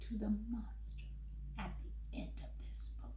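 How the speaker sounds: whispered, fearful hush. Acted. Grover whispers to the kids about the monster. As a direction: whisper it so the monster won't hear.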